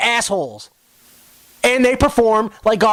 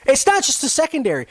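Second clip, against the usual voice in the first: ordinary talk, nothing marked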